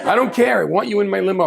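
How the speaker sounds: emphatic insistence. Retold or imitated. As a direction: brush the objection aside loudly. Retold insistence.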